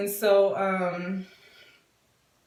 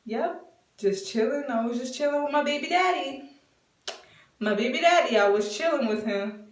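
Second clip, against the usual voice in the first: higher, playful mock-casual voice; re-enacted silly teasing, pitched up; silly hinting; 6 semitones higher than usual. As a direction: drop a big hint as if it's nothing. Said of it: "just doing it like that".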